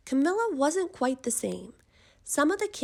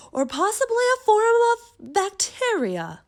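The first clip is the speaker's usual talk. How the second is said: much higher, loud put-on expert voice; expert's theory; 12 semitones higher, 10 dB louder than usual. A narrator's reading. Expert offers his guess about Camilla, high and loud. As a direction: pipe up with a squeaky professor voice. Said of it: "Mister Mellon said".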